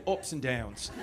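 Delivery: Australian accent. Shown as a demonstration. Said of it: "as you would say".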